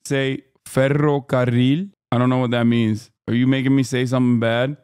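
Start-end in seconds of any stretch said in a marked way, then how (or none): 0.7-1.9 slow "ferrocarril" with trilled double R; rolled R. Shown as a demonstration. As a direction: roll both R sounds in "ferrocarril". Asked to say a trilled R word.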